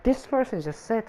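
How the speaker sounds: normal talking voice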